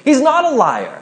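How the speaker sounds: a hint of an earnest follower defending Jesus; defending disciple. Pastor voices a disciple answering the critics, lightly voiced. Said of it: "he's like".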